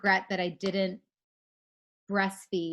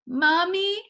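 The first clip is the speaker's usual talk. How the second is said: put-on high sleepy little kid voice; child calling mommy; 11 semitones higher, 5 dB louder than usual. Toddler waking mom at night, high little voice. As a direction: whine it like a small child at the door.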